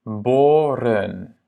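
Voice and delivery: Boren slowly, with a long O; long first vowel. Shown as a demonstration. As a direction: stretch the O in Boren. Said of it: "la première voyelle est longue". (« Boren » lentement, O bien long)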